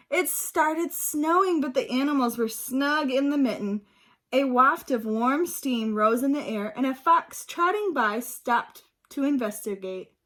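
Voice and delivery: put-on reading aloud to young children, lively; storybook reading. A narrator's reading. Teacher reading a picture book to her class, lively. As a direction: bring the story alive for the class.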